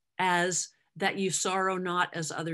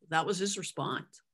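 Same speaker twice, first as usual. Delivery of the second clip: ordinary delivery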